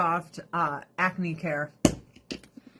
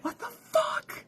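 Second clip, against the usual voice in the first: much higher, shocked outburst; outraged; 10 semitones higher than usual. Retold or imitated. Reacting to child marriage, high shocked outrage. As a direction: blurt it out in disgust. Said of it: "And I'm like".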